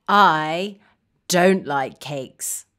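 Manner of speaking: low, slow, downcast; deep, deliberate glum refusal; glum dislike. Shown as a demonstration. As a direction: say it low and disappointed, word by word. Modelling dislike of cakes.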